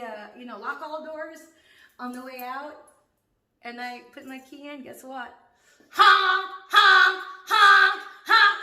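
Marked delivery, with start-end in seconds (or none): 5.9-8.6 loud, high honking horn noise; imitating car horn. Mimicking her car alarm going off. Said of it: "It's so annoying".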